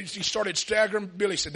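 ordinary delivery

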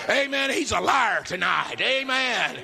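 high, loud rousing preacher shout; preaching at full force. Acted. Preacher rallying his congregation, full-force shout. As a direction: whip the congregation up toward victory.

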